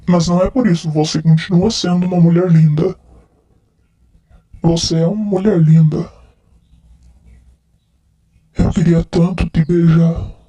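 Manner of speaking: lightly slurred, tipsy declarations of love; drunk man. Acted. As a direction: play a drunk guy sweet-talking his friend. Nossa, eu tô muito bêbado.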